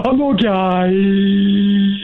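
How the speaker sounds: hugely drawn-out last word; drawn-out prank. Pretend. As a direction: hold the final vowel absurdly long. Prank caller stretches out the words.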